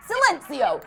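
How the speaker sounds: furious machine yell. A narrator's reading. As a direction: shout the translated order. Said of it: "sounding just as furious whether it was yelling".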